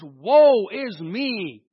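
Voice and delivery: loud, drawn-out WOE IS ME; strong emphasis. Retold or imitated. Preacher quoting Paul's strong language, emphatic. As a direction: hammer each word of "Woe is me" slowly. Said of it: "That's strong language".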